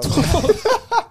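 sudden high cackle; high burst of laughter. Real, not acted. Laugh after camel joke.

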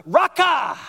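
sharp high shout of Raka; shouted insult. Shown as a demonstration. Pastor showing the church how Raka was hurled, shouted. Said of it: "'cause you gotta say it like that".

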